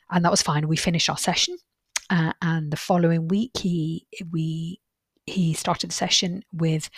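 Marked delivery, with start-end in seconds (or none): none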